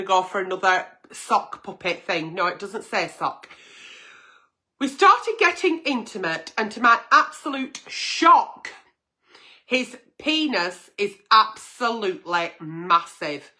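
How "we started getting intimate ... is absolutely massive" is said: reading a letter. Acted. Agony aunt reading a viewer's letter, lightly performed. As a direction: read the letter with a hint of drama. Reads listener letter aloud.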